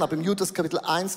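ordinary talk

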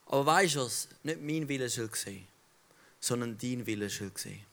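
Jesus quietly accepting the Father's will; quiet surrender. Acted. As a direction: hand it over to God softly.

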